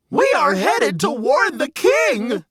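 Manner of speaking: high, urgent barnyard cry; urgent shout. Acted. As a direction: call out the mission together. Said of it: "The two shouted in unison".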